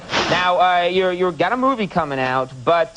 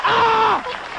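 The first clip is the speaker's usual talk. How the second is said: short high shrieking "Ah!"; death scream. Shown as a demonstration. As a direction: let out a sharp death scream. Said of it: "So you could have gone for, like, a".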